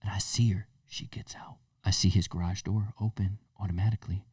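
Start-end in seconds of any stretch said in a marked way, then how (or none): 0.0-1.6 hushed spy whisper. Retold or imitated. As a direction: whisper it like you're hiding and watching. Spying from the window, whispering.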